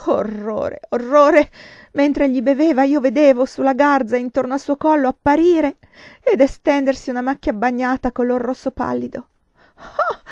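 loud, high, horrified outburst; mounting horror. A narrator's reading. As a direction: cry it out, appalled and panicking. Horrified narration of bleeding, rising alarm.

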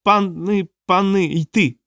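high, piping creature voice; little creature. A narrator's reading. Small creature explaining itself to Rokanon, high little voice. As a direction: chirp it like a small timid animal. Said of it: "сказало маленьке створіння".

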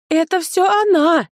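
high, loud, drawn-out accusation; accusing shout. A narrator's reading. Steward blames the midwife before his lord, accusing shout. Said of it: "вскричал управляющий и показал на меня пальцем".

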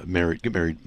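usual speaking voice

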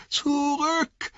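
very high, strained call; thin, shrill cry. A narrator's reading. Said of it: "schrill seine Stimme klang". (dünner, schriller Ruf)

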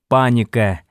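ordinary delivery

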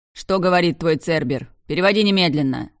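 loud demanding shout; yelling king. A narrator's reading. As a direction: bark the order furiously. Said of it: "заорал король".

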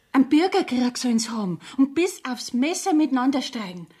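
ordinary delivery

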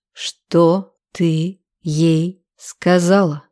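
slow growled demand, word by word; very slow, controlled menace; merciless growl. A narrator's reading. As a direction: press each word out without mercy. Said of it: "Безжалостно прорычал я".

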